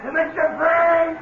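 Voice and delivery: alarmed shout. Acted. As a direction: shout him back out of the corral. Mac yells at Frank near horse.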